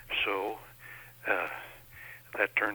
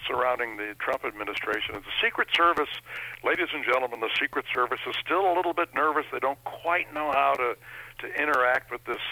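ordinary delivery

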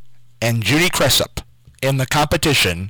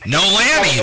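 usual speaking voice

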